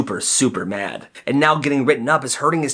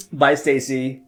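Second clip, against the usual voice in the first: flirty laughing bye; slower than usual. Acted. Leaving after asking her out.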